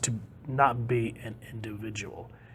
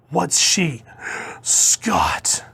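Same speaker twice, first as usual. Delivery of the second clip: gasping, shocked reading; gasp, then say it aghast; mock shock; 4 semitones higher, 3 dB louder, much slower than usual. Acted shocked reaction to the tape.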